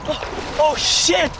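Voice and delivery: gasping yells from icy water; shocked by the cold. Real, not acted. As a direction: cry out from the sudden cold. Jumping into an icy lake.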